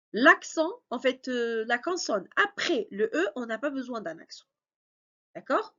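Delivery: ordinary talk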